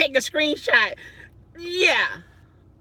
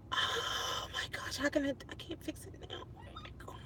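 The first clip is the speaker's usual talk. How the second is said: mortified whisper; 19 dB quieter, faster than usual. Real, not acted. Mortified, talking under her breath.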